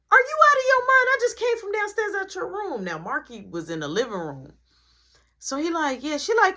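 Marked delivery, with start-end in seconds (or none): 0.1-2.8 high, loud furious mom shout; angry mom yelling. Retold or imitated. Mom shouts at her son for taking his stuff, furious. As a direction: blast him like an outraged mother.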